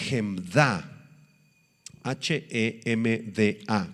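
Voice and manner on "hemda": "Hemda" stressed on the final A; final-syllable stress. Shown as a demonstration. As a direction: say "Hemda" with the accent on DA. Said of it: "con acento en la".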